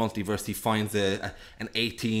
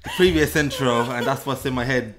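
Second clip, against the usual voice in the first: ordinary delivery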